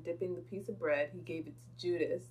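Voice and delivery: usual speaking voice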